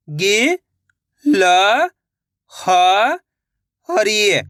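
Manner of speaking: "गि-ल-ह-री" very slowly, syllables apart; sounding out slowly. Shown as a demonstration. Teacher showing children how to sound out a word. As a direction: sound out "गि-ल-ह-री" piece by piece. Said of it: "एक एक अक्षर का उच्चारण करके".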